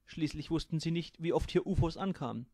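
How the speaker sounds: ordinary delivery, nothing marked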